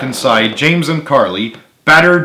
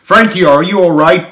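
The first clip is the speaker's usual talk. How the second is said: worried; 7 semitones higher, 6 dB louder than usual. A narrator's reading. Joey worried for Frank.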